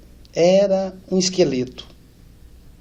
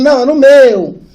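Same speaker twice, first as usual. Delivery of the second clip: higher, louder eager claim; excited sister; 9 semitones higher, 11 dB louder than usual. Retold or imitated. Sister claiming the brother for her room, excited. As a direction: blurt it out excitedly.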